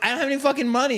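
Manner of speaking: high, strained retelling of his panic; exasperated cry. Retold or imitated. Patient telling the urologist he has no money, high and strained. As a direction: voice jumps up in disbelief. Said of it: "I'm like".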